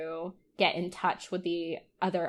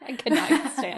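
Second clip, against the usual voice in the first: higher, louder, through real laughter; quick words tumbling out mid-laugh; 5 semitones higher, 5 dB louder, faster than usual. Real, not acted. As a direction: say it while cracking up. Laughing over the bad accent.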